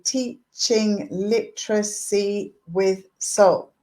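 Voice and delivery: slow, each syllable of "Teaching literacy with soul" separated; spaced syllables, counting as you go. Shown as a demonstration. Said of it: "So if I say".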